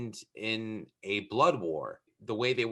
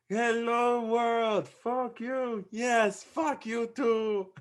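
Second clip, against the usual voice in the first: high, exuberant film-quote voice; movie-scene impression; 12 semitones higher, much slower than usual. Quoting a cheerful newcomer greeting New York, high mock call. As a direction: call it out high, stretching the last words. Quotes lines from Coming to America.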